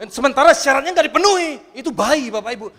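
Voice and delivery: loud, high, fervent sermon cry; full-force preaching. Acted. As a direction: thunder it at the congregation. (khotbah berapi-api dengan suara lantang)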